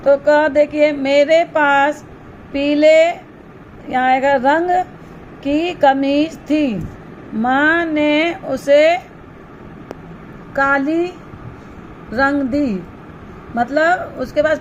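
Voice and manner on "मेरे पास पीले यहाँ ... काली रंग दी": slow, spaced reading of the example sentence; teacher dictating a sentence to the class; slow dictation. Shown as a demonstration. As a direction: read it word by word so children can write. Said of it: "teacher reads example sentence slowly".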